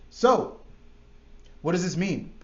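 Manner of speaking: ordinary talk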